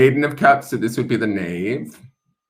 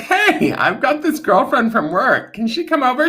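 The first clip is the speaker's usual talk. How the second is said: much higher, bubbly friend voice; high girly voice; 12 semitones higher than usual. Retold or imitated. Card women inviting a friend over, high girly voice. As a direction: squeal it like an excited party girl. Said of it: "They're like".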